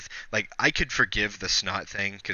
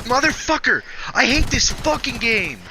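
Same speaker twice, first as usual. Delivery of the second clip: furious yell, much higher and louder; raging at the game; 13 semitones higher, 5 dB louder, slower than usual. Real, not acted. Gamer raging at the game after dying: furious yell. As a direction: scream at the game like you just got robbed.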